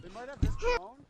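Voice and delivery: high, loud urgent yell; alarmed shout. Real, not acted. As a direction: warn them sharply, high and loud. Urgent warning during firefight.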